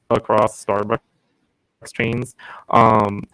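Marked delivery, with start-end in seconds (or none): none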